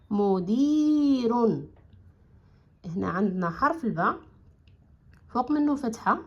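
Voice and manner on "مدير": مدير drawn out very slowly, each sound stretched; slow blended word. Shown as a demonstration. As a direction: stretch مدير out syllable by syllable for the kids. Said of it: "غتعطينا كلمة مدير".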